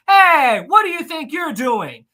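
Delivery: loud, high mock steward yelling; angry steward. Steward catching Jack and Rose at the door, loud mock yell. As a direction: bark at them like an officious steward. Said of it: "comes up and goes".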